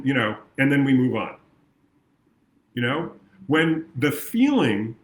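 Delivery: ordinary delivery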